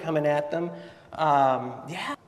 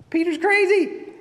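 much higher and louder, bursting out; high exclamation; 7 dB louder than usual. Real, not acted. Preacher marvels at Peter to the congregation, loud high cry. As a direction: blurt it out in disbelief.

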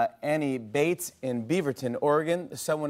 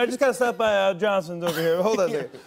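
pretend phone aside; 7 semitones higher, 4 dB louder than usual.

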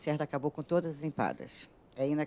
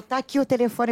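excited; 9 semitones higher, 9 dB louder than usual. Retold or imitated. Retelling an excited phone call.

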